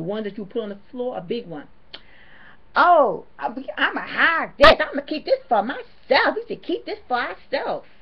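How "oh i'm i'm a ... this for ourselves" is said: loud, high mock of a greedy worker. Vlogger mocking the Goodwill worker, loud and high. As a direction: ham up the sneaky worker grabbing stuff. Mimicking worker hiding items.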